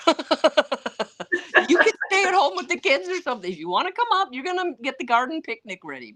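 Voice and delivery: high-pitched teasing quote with laughter; mock domestic banter. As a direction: tease them in a high, laughing voice.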